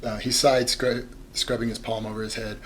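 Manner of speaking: usual speaking voice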